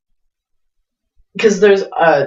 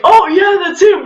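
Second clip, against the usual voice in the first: mock excited yell; 14 semitones higher, 4 dB louder than usual. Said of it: "We're like".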